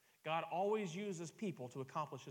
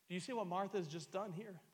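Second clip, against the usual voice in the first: ordinary talk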